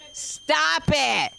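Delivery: high, drawn-out "stop it"; exasperated plea. Acted. Comic begging women to stop the Valentine's fuss, high exasperated plea. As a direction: plead it up high, stretching the words.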